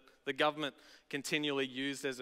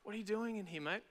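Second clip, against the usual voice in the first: soft, higher-pitched kindly question; tender, quiet coaxing; gentle; 4 semitones higher, 9 dB quieter, faster than usual. Retold or imitated. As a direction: ask it gently, like a mate. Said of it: "gently saying".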